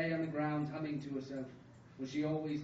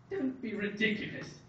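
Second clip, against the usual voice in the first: raised-voice father's retort, mildly shouted; a touch of a shout, higher pitched; 5 semitones higher, 4 dB louder than usual. A narrator's reading. Father dismisses dying mother's fears, mildly shouted. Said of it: "shouted Joseph's father".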